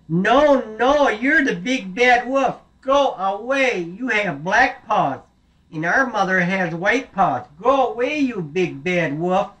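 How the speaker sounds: high, loud and slow, chasing the wolf away; goats shouting at wolf. Acted. Little goats shouting at the wolf through the door, high and loud. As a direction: shoo him off from behind the door.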